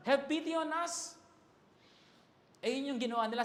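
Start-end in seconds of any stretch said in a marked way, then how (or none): 0.1-1.2 raised, pitched-up plea to a distant Jesus; pleading call. Pretend. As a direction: call out to Jesus from far away, begging. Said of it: "Sumisigaw sila".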